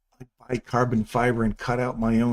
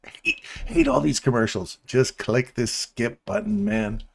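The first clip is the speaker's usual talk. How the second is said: 1 imitating Russell. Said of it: "Russell's like".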